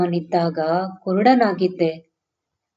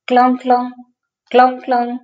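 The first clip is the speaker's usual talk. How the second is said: higher, louder ringing clang of a hammer; clanging tool sound; 5 semitones higher, 4 dB louder, much faster than usual. Retold or imitated. Blind boy recalling his father's workshop, imitated hammer clang. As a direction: ring it out like metal on metal. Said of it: "ಅಪ್ಪನ ಕೆಲಸದ ದನಿ".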